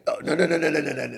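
high, loud rapid string of no; frantic no. Acted. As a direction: cut him off in a panic. Frantic refusal to hear it.

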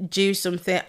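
ordinary delivery

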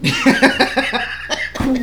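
high, loud burst of laughter; big laugh. Real, not acted. Reacting to "be offended" joke, real loud laughter.

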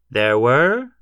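loud preacher's proclamation, pitch swept up; pompous, rising pronouncement; pontifical. A narrator's reading. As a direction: intone it like scripture from a pulpit. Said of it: "he said pontifically".

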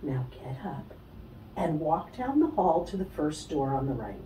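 a calm guiding voice only she can hear; calm inner voice. Retold or imitated. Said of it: "I hear Spirit say".

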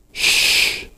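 long unvoiced shush; hushing shh. A narrator's reading. Roger silencing Angie after her scream, hushing. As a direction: hush them with a drawn-out breath.

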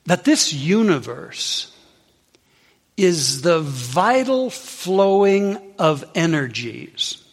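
slow, drawn-out teaching point; slow emphasis. Real, not acted. Preacher stressing the key practice, slowly. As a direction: lean on each word. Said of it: "slow emphatic teaching".

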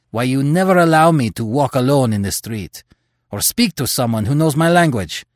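angry accusation. Acted.

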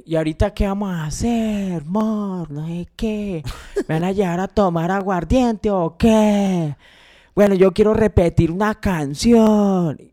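lightly hammed-up pop star chatting on stage; imitating the singer. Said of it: "ella empieza como a hablar".

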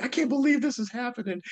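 high imitation of giddy disbelief; excited homeless man. Homeless man let into the club, imitated in excited disbelief. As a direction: imitate him overjoyed, pitched well up. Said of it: "like, so excited".

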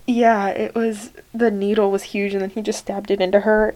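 ordinary talk

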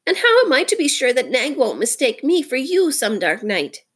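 high-pitched, fretful worrier; sorrowful. A narrator's reading. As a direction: fret mournfully in a high creature voice. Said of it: "more sorrowfully than ever".

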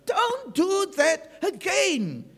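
high, slow, emphatic self-scolding; self-reprimand. Acted. Preacher acting out a believer correcting himself after prayer, slow self-reprimand. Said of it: "you tell yourself".

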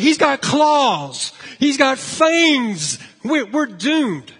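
loud, high, drawn-out mock terror; hammed-up cries of doom, stretching the words; mock screaming. Disciples convinced a monster is coming, mock screaming. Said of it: "I mean, they're screaming".

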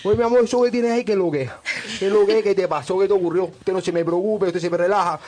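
playful Cuban guide, fatherly and blessing; Cuban accent. Retold or imitated. Guide comforting the injured guest, mock Cuban accent. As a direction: impersonate the Cuban guide soothing a hurt tourist, Cuban accent. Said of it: "no supera el acento cubano".

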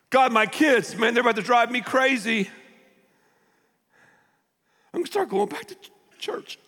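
high, pleading cry; desperate plea. Acted. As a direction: beg like you're at your wits' end. Acting desperate prayer.

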